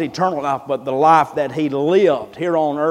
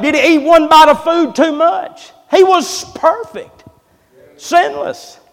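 preaching at full force; 10 semitones higher, 6 dB louder than usual. Acted. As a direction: stretch each word out to the church. Preaching climax on sinless life.

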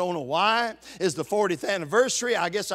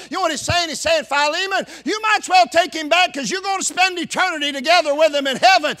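fervent preaching; 4 semitones higher, 4 dB louder than usual. Acted. Preacher voices Paul's letter fervently.

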